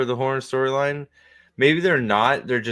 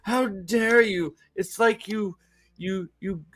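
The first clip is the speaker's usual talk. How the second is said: high, whiny indignant protest; much higher, stammering mock indignation; mock outrage; 9 semitones higher than usual. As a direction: sputter in hammed-up betrayal. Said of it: "And they're like".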